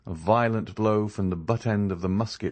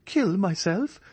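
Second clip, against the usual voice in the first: much higher, shocked question; incredulous cry; 12 semitones higher, slower than usual. A narrator's reading. Andrea reacts to Eugénie's advice, startled. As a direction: recoil at the idea. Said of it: "he cried".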